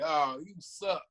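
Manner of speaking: mocking trash talk. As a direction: drag it out to belittle the kid. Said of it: "guys telling you".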